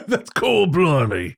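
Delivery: high put-on London accent. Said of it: "do my London accent".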